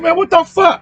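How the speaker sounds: high-pitched mock "what the"; annoyed outburst. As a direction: yelp it like you got slapped from behind. Imitated annoyed reaction to slap.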